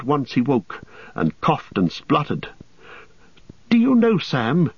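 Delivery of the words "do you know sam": high-pitched hobbit, coming round after a soaking; Frodo's high, earnest voice; shaken Frodo. A narrator's reading. Frodo confiding in Sam after nearly drowning, shaken and high-pitched. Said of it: "he said at length".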